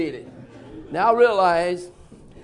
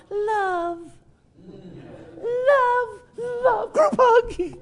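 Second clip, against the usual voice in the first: mock sappy angels; much slower than usual. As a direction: coo it like a sugary greeting card. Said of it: "they weren't flying around and going".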